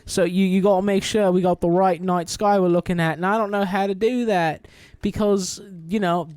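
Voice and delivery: put-on a light British accent, fussy and eager. Steven to Layla about the night sky, light British accent. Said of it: "Steven takes over and he's just like".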